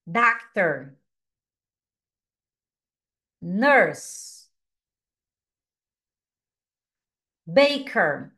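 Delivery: each English word said clearly, with "Manager" slowed; careful teacher model of each word; teacher modelling job words for students to repeat; model pronunciation. Shown as a demonstration. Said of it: "Mais uma vez".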